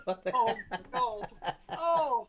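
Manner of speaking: helpless, amused exclamations between laughs; laughing. Real, not acted. Host reacting to co-host's DJ joke, laughing. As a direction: groan through real laughter.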